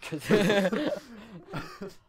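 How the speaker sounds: loud, high laugh; sudden high cackling laugh; burst of laughter. Real, not acted. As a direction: crack up loudly.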